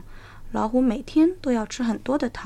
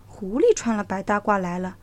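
ordinary delivery

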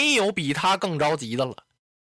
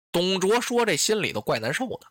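usual speaking voice

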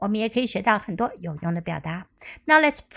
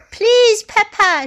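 put-on pleading child; 10 semitones higher, 7 dB louder, slower than usual. Clara begs her father.

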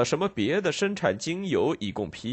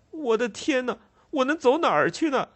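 put-on much higher, anguished child's cry; desperate cry; 13 semitones higher than usual. Ragged boy crying out in despair, very high. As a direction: cry out in despair, tearing at the hair. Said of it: "那孩子喊道".

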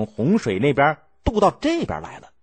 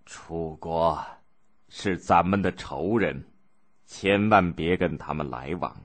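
put-on softer, slow, weak voice of a wounded old ruler; dying duke's last words; 6 semitones lower, 6 dB quieter than usual. Wounded Duke Xiang gives his dying instructions to the crown prince, faint and slow. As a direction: speak faintly, as if on a deathbed.

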